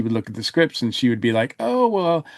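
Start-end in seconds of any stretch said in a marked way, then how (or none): none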